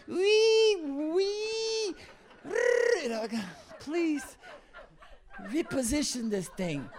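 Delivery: high squealing wail of an out-of-tune flute, then a pleading laugh; pained squeal. Retold or imitated. Preacher mimicking the off-pitch flute with a high pained squeal.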